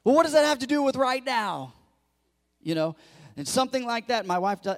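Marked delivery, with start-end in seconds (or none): 0.0-1.8 louder, high-pitched exasperated husband; mock exasperation. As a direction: act out protesting to a wife over a needless scare. Husband exasperated at wife's worry.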